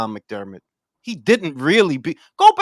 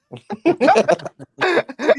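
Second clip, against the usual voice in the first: laugh; 11 semitones higher, 4 dB louder than usual. Real, not acted. Laughs at own joke.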